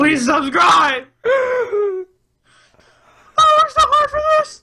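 high, loud, crying plea; desperate begging. Acted. Begging the audience to subscribe, high and desperate. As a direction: beg the viewers like your life depends on it.

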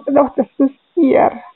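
holding back tears, voice unsteady; composure breaking. Real, not acted. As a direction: voice catching with tears. Interviewer emotional about painful story.

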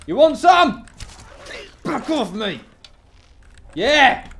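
high, loud challenge; yelling a dare at a foe; loud taunt. Real, not acted. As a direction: shout it at the enemy, spoiling for a fight. Taunting enemy in fight.

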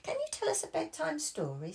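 high hopeful request; high childish request; eager Peppa. Acted. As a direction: ask for a story sweetly. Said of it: "said Peppa".

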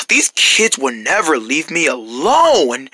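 slow, low sigh of complaint; exasperated grumble. Acted. Neighbor grumbles to himself.

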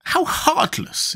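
very high, slow gasp of disapproval; shocked tin man; shocked exclamation. A narrator's reading. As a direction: exclaim it high and drawn out, appalled. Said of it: "exclaimed the Tin Woodman, greatly shocked".

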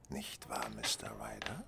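a hushed, pointed whisper; menacing whisper. Acted. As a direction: whisper the name to corner him. Detective confronts the thief.

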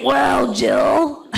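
hammed-up loud indignation; loud retort. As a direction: call her out with a booming voice. Acted shocked retort to the woman.

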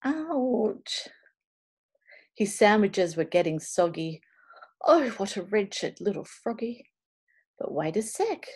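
ordinary delivery, nothing marked

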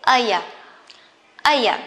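"aya" as two weak syllables run together; linked, reduced "aya"; reduced "are you". Shown as a demonstration. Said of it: "you can say aya".

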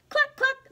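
high cluck. Acted. Chicken answers Duck with high mock clucks. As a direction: cluck like a hen.

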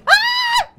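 sudden high piercing scream; startled scream. Real, not acted. Player startled by the killer, real scream. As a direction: jump out of your skin and shriek.

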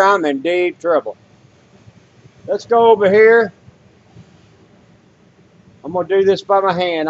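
normal talking voice

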